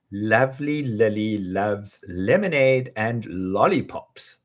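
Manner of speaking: slow, careful first take; slowly. Shown as a demonstration. Teacher modelling the twister slowly. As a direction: ease through each L. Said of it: "slow first take".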